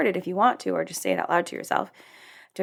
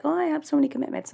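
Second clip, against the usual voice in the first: higher, quicker mock excuse; surface-level excuse; 7 semitones higher, faster than usual. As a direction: toss it off as a shallow excuse. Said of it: "all the surface level stuff".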